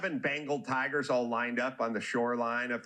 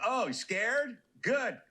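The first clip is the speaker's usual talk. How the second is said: higher mocking bird, lightly voiced; taunting bird voice; 9 semitones higher, slower than usual. Crane mocking the scared tigers, higher taunting voice. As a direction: needle them, pitched up and smug. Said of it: "the bird's like".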